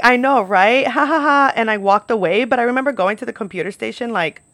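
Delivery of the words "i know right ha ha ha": loud, spelled-out fake laughter; fake polite laugh. Waitress brushing off the judging customer, forced laugh. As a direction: mimic laughing along, raised and loud. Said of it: "kinda laughed and I was like".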